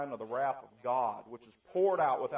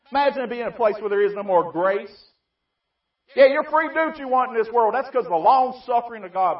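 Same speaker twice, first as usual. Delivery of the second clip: louder, higher preaching build; rising preacher's cry; 10 semitones higher, 17 dB louder than usual. Acted. Preacher pressing the crowd about lost mercy, rising shout. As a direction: lift the repeat into a fiery plea.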